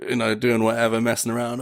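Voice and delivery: ordinary talk, nothing marked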